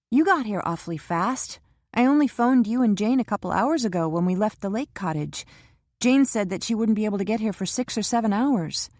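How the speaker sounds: Kara anxious in the waiting room; young Kara voiced. A narrator's reading. Kara's voice in the novel.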